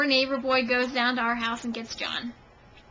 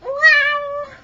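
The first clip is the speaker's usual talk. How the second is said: loud, high, drawn-out wow; high pet cry; 11 dB louder than usual. Pretend. Owner playing with her cat on camera, high cry. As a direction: draw it out high and loud like a meow.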